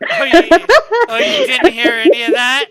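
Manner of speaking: loud laughing disbelief; surprised. Real, not acted. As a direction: shout it in shocked surprise. Shocked nobody heard the impression.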